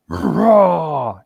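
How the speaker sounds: big imitated beast growl; loud throaty growl imitation. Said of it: "just real, real loud".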